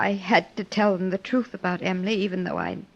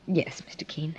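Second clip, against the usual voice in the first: hushed whisper; 4 semitones lower, 8 dB quieter than usual. Acted. Widow admits housekeeper's hopes.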